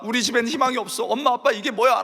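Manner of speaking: despairing spouse. Acted.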